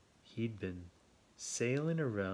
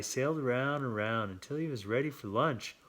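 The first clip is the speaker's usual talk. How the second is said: ordinary delivery, nothing marked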